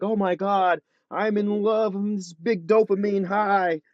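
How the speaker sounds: high, swoony imitation of infatuation; mock lovestruck. Guest mocks movie ideas of love, gushing high. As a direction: gush like a movie lover, drawing out the end.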